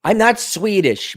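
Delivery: usual speaking voice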